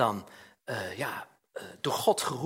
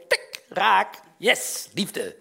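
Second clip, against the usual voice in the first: playful cheer; 3 semitones higher, 5 dB louder, much slower than usual. Pretend. Cupid's arrow hitting the heart.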